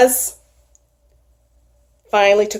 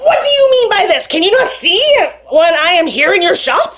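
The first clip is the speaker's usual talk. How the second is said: indignant tiny bee; 7 semitones higher, 4 dB louder than usual. Acted. Angry tiny bee scolds.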